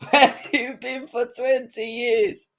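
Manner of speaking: high, slow mocking repeat through a laugh; laughing taunt. Real, not acted. Taunting Arsenal fans, laughing mock question. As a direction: laugh it back at them.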